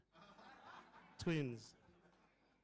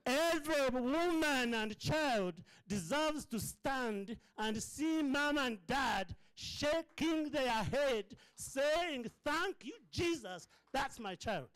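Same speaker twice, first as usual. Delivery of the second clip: high, slow, impassioned preaching; 9 semitones higher, 4 dB louder, slower than usual. Real, not acted. Preacher urging every child deserves this, soaring. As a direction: soar and linger on each phrase.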